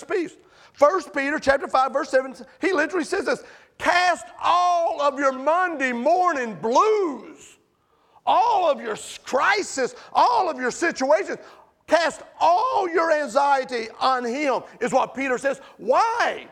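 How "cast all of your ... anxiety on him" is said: preaching scripture. Acted. Preacher quotes scripture with emphasis.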